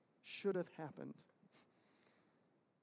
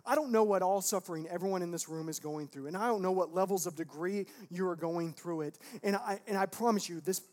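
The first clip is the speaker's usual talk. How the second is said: impassioned preaching; 3 semitones higher, 8 dB louder than usual. Real, not acted. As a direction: press the promise on the congregation, growing more forceful. Preaches with rising force.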